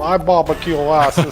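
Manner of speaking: slow mock American hillbilly accent. Mocking how people picture American English, slow hillbilly accent. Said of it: "the hillbilly".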